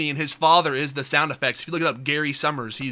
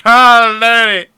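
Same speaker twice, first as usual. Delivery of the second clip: loud, higher mock exclamation; acted loud outcry; 7 semitones higher, 13 dB louder, much slower than usual. Gamer describing his filthy bender, acted loud outcry. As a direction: blurt it out much louder. Acting out his wrecked gaming self.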